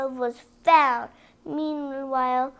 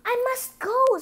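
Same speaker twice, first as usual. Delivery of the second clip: high, louder, hurried alarm; urgent cry; 11 semitones higher, 4 dB louder than usual. Acted. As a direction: Cinderella suddenly realising midnight has come. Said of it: "Cinderella cried".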